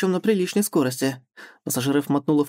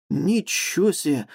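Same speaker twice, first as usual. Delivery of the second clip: impressed whistle; slower than usual. Acted. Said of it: "присвистнул герой".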